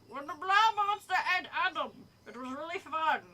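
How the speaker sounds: lightly voiced eager creature; green monster figure. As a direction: play an enthusiastic monster fan, lightly. Green creature's line, first take.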